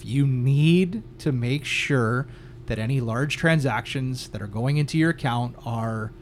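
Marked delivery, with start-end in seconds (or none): 0.0-2.3 need drawn out slow and firm; slow, emphatic you need to make sure; stress on need. Real, not acted. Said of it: "I cannot stress enough".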